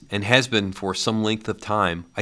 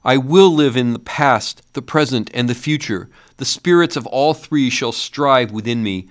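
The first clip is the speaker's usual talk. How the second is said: higher, louder desperate promise; agonized vow; 6 semitones higher, 7 dB louder than usual. A narrator's reading. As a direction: swear it in anguish. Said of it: "In his agony".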